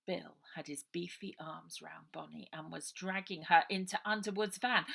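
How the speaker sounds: usual speaking voice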